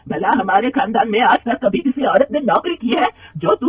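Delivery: angry retort from the mother-in-law. Acted. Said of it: "गुस्से में कहती है".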